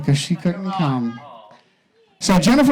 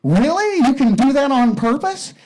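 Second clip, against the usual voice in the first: much higher, eager and thrilled; excited wife; 7 semitones higher than usual. Acted. Wife hearing the trunk story, high excited voice. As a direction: gasp it out, giddy with excitement. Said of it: "she starts getting excited".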